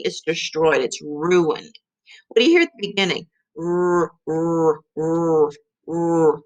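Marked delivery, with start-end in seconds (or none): 3.6-6.4 repeated growled rrr sound. Shown as a demonstration. Teacher sounding out the letter R for kids. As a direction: model the R sound for the class. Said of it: "What letter is that?".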